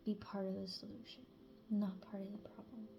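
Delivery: whispered gentle thanks; soft thanks. Real, not acted. Reader thanks listener for choosing peace, whispering. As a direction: breathe the words softly, grateful.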